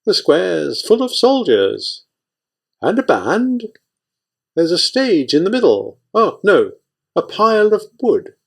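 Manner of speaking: lilting report that suddenly sees the pyre; sing-song voice. Acted. Lookout announces the square in a high sing-song. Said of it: "in the same sing-song voice".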